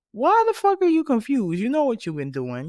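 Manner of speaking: high, loud, fed-up yell; exasperated outburst. Real, not acted. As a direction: blurt it out in disbelief.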